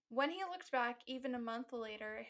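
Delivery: ordinary talk, nothing marked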